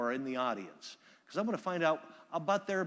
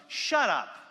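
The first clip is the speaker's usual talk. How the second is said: higher, louder heckler yelling; heckler shout; 7 semitones higher, 6 dB louder than usual. Retold or imitated. Speaker imitating the heckler in the back, shouting. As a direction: bark it from the back of the room. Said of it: "in the back of the room shouts".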